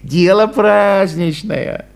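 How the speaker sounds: indulgent, slow, with a chuckle; mild condescending chuckle. A narrator's reading. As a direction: brush it off with a laugh. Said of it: "снисходительно сказал".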